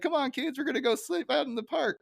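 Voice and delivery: high cheery dad voice; Ned Flanders. Retold or imitated. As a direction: call the kids up in a sing-song chirp. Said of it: "He's like".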